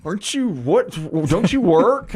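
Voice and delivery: mock disbelief from the son; surprised son. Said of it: "He goes".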